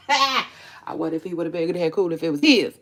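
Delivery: laughing through the words. Real, not acted. Laughing to viewers about her boyfriend. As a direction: giggle while saying it.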